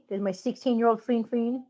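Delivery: normal talking voice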